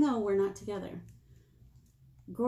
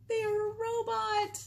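much higher, drawn-out guess; high slow answer; 13 semitones higher, slower than usual. Acted. Librarian answering the riddle, high and slow. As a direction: announce it with delight.

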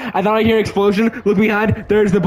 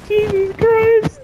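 scared horror game streamer; 12 semitones higher, much slower than usual. Real, not acted. Panic while chased.

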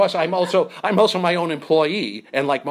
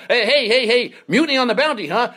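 mock outrage; 10 semitones higher, 5 dB louder than usual. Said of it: "So I said".

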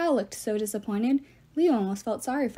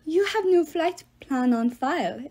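slow, higher, bad French accent; fake French accent; 6 semitones higher than usual. A narrator's reading. Love god stops the flyers, bad French accent. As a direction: put on a terrible French accent and draw it out. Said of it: "he had a French accent so bad".

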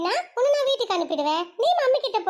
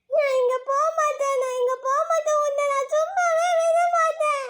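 put-on bratty kid threat; 7 semitones higher than usual. Child warns teacher her dad will hit her. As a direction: threaten like a cheeky little girl.

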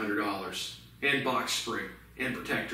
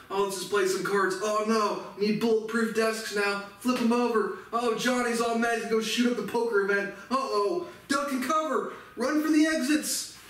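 high, frantic mock panic; panicky poker player; 10 semitones higher than usual. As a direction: squeal it like a scared card player.

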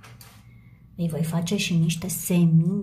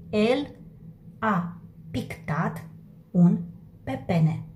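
slow dictation; slower than usual. Shown as a demonstration. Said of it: "teacher dictates word by word".

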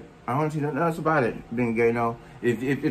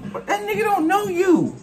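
high squeaky mock voice, much higher; mocking falsetto; 3 dB louder than usual. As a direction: mimic him in a silly high voice.

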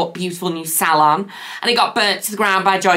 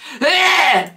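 a sudden hacking cough; real cough; 10 semitones higher than usual. Real, not acted. Sick vlogger interrupted by a cough, hacking cough. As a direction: cough mid-sentence.

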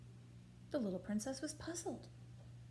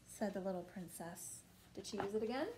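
usual speaking voice

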